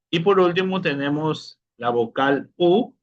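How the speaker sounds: normal talking voice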